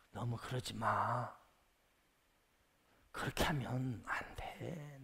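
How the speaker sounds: very low, soft and drawn-out; hushed dissuading. Acted. Jaded believer discourages an eager one, hushed and slow. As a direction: talk them down slowly under your breath.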